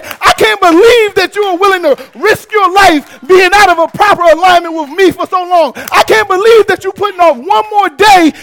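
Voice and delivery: loud, high, driving preacher's climax; preaching at full force. Acted. Preacher warning the congregation that God sees their risk, full-force shouting. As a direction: shout it out like a revival peak.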